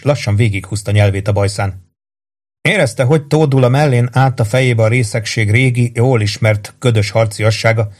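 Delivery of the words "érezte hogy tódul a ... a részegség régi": ordinary delivery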